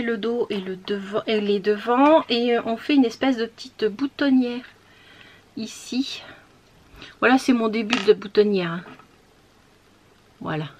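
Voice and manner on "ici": whisper. Real, not acted. Pointing at buttonhole, whispered.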